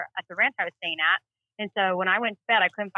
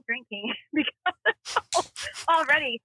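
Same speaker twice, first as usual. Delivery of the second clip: laughing; 5 semitones higher, much slower than usual. Real, not acted. Laughing about drinking early.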